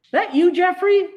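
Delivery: high, loud call across the street. Acted. Amanda calls out to Jeffrey from her bike, high loud call. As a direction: call to him from the curb, voice way up.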